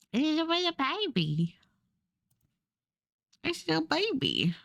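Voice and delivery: put-on high cutesy baby-talk voice; high squeaky coo over something tiny. Cooing over the drawing.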